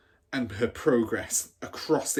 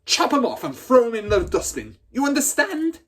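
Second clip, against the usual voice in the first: much higher and louder, rising demand at the end; angry bark; 12 semitones higher, 8 dB louder than usual. Acted. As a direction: snarl the order and demand an answer. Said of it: "she barked".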